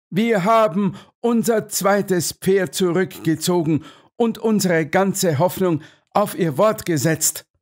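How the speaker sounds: high, insistent colonel's voice; colonel's dialogue. A narrator's reading. Colonel tells Holmes all hope rests on him, high and insistent.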